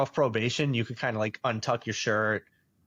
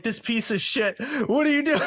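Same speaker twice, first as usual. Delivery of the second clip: half-laughing mock yell, pitched up high; mock outrage, laughing; 10 semitones higher than usual. Retelling how the senior guys yelled at him, half-laughing mock outrage. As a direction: ham up the guys' outrage and crack up at the end.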